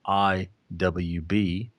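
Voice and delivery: each letter slow and separate; spelled out slowly. Shown as a demonstration. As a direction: spell it out deliberately. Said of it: "in military speak".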